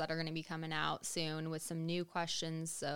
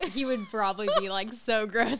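loud laugh through the words; cracking up mid-sentence; laughing loudly; 3 semitones higher, 8 dB louder than usual. Real, not acted. Laughing at the feet joke.

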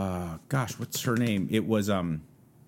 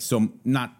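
usual speaking voice

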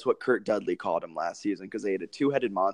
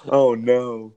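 mock dismay; 7 dB louder, much slower than usual. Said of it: "I was like".

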